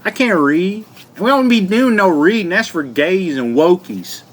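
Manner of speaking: mock Southern hillbilly voice; dumb hillbilly. Mocking hillbillies who won't read, dumb hillbilly voice. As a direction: play a proudly ignorant hillbilly. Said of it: "I'm a hillbilly".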